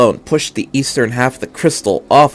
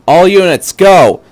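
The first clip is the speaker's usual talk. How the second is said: loud sharp order to all units; commanding shout; 7 semitones higher, 8 dB louder than usual. Acted. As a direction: bark the attack command. Misato orders attack.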